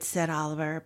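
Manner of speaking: ordinary delivery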